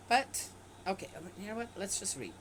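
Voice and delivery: ordinary talk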